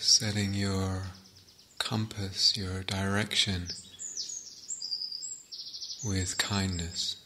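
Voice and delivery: guided meditation. Acted.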